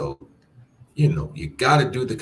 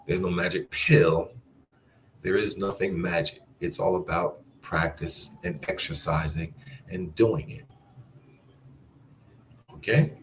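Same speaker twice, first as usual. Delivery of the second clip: ordinary talk, nothing marked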